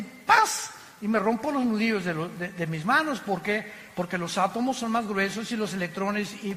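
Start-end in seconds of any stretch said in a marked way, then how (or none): 0.0-0.7 sudden high-pitched impact noise; sharp, high "bam"; imitated smack. Shown as a demonstration. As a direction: punch out the sound of a blow. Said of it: "le hago este púlpito así".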